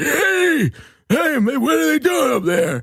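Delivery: put-on high yelling old uncle; drunk uncle. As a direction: call out like a rowdy uncle. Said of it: "some old uncle's like".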